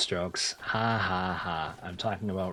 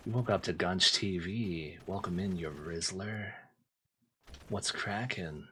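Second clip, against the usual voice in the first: softer, close-mic mock whisper; mock ASMR whisper; 3 semitones lower, 5 dB quieter than usual. Streamer welcoming chat in mock ASMR, soft. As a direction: pretend ASMR right in the ear. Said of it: "I speak very softly and right into the mic".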